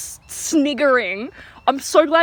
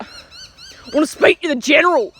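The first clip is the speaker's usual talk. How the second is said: gruff tough guy demand, a bit louder and higher; 7 semitones higher, 4 dB louder than usual. Retold or imitated. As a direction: play the big tough customer making demands. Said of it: "and he's like".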